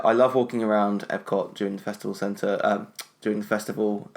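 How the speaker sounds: ordinary talk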